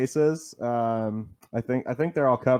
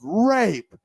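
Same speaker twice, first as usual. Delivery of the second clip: a sharp, much higher and louder shout of the one word; audience heckler yell; 9 semitones higher, 4 dB louder than usual. Retold or imitated. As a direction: blurt it out loud from the crowd like a heckler. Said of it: "some dude yells out in the audience".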